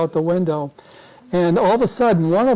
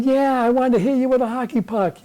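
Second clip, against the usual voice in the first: lightly voiced president, pitched up; imitated president; 7 semitones higher than usual. President answering a reporter, lightly imitated high comeback. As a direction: answer back high in a put-on voice.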